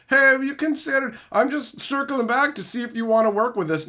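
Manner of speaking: high, mock-sweet marketer voice. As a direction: parody a middleman circling back. Mocking marketer emails.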